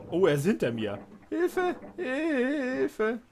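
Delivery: high, loud mock cries for help; panicking gamer. Fish chasing him underwater. (panischer Gamer)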